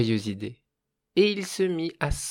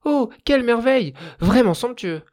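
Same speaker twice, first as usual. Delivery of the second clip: ecstatic cries; 10 semitones higher, 6 dB louder than usual. A narrator's reading. Fire spirit marvelling at wood and stones, ecstatic. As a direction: gush over each treasure like a child. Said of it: "et poussait des".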